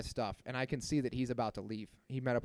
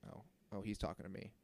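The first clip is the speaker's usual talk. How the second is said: hushed mock realization; 3 semitones lower, 9 dB quieter than usual.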